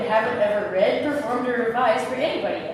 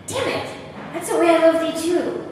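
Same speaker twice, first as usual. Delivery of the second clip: higher, hammed-up frustrated outburst; mock exasperation; 6 semitones higher than usual. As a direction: blurt it out, annoyed at yourself. Catches herself confessing love again.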